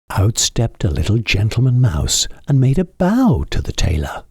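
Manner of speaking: ordinary delivery, nothing marked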